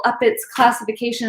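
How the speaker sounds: normal talking voice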